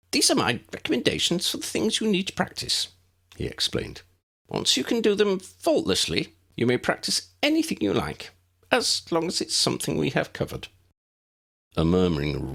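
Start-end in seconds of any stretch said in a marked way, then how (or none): 0.1-10.7 high, bright little wizard voice, kept up; a small, eager professor speaking; tiny professor voice. A narrator's reading. Flitwick voiced in story.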